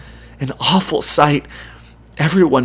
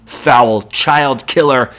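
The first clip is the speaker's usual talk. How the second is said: louder, slow, contemptuous; spat insult; 2 semitones higher, 5 dB louder, slower than usual. A narrator's reading. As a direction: spit the words out at her. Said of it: "Jason spits back".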